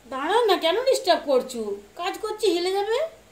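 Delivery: sharp, high, loud scolding; annoyed scolding. Real, not acted. Mother scolds her children for disturbing her work, high loud irritated scolding.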